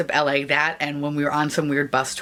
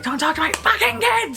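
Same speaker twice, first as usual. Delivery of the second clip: high-pitched imitation of mom yelling; mock an angry mother's high yell; retold yelling. Mom to dirty clown about her kids, mock yelling. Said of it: "yelled at him".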